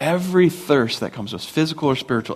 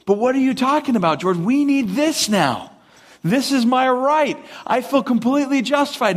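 high-pitched, insistent mock protest; indignant complaint; 9 semitones higher than usual. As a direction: push back like an entitled complainer. Complaining Israelites push back at leader.

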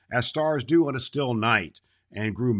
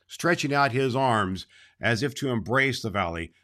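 usual speaking voice

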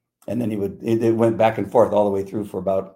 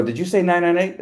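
much higher, mock-disbelieving check; incredulous dispatcher; 10 semitones higher, 4 dB louder than usual. Dispatcher checking the shooting code, incredulous. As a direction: imitate someone doubting what they heard.

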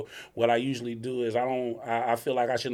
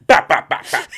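barber clipper noise; 2 semitones higher, 11 dB louder than usual. Retold or imitated. Mimicking clippers again.

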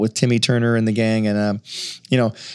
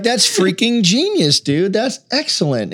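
slow impressed dude voice; 7 semitones higher, slower than usual. Retold or imitated. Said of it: "we're like".

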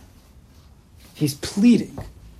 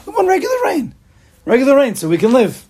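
much higher and louder, begging; pleading with God; 11 semitones higher, 4 dB louder than usual. Retold or imitated. Choni begging God for normal rain, high and loud. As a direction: cry out to heaven for ordinary rain. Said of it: "Choni Magal says".

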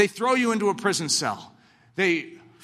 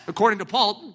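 ordinary talk

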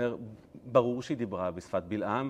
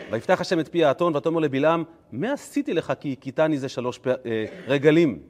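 loud, raised-pitch verse reading; dramatic recitation; 5 semitones higher, 9 dB louder than usual. Acted. Teacher reciting what the donkey said to Balaam, dramatic recitation. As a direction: declaim the verse loudly.

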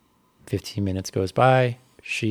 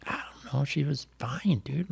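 whispered, hushed, a little higher; hushed aside; 6 semitones higher, 6 dB quieter than usual. Retold or imitated. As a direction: whisper it aside to your son. Mom whispering at the race tent.

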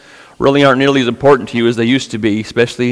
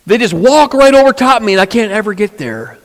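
high, plaintive complaint rising in pitch; pleading paralyzed man; 7 semitones higher than usual. Retold or imitated. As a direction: whine it like a helpless man begging. Paralyzed man protests to Jesus.

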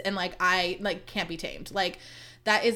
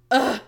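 loud groan of disgust; exasperated ugh; 3 semitones higher, 7 dB louder than usual. Real, not acted. Podcaster reacting to his half-hearted response, exasperated ugh. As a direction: groan it out, fed up.

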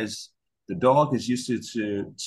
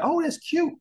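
much higher doting "oh, that's cute"; cooing parent. Retold or imitated. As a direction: gush over it like a clueless parent. Parents voiced cooing at dog.

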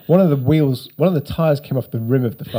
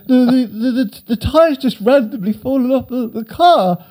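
much higher, louder, stammering; flustered teen; 12 semitones higher, 4 dB louder than usual. Retold or imitated. Teen explaining the crash to walker, high flustered stammer. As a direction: stumble out the excuse pitched up.

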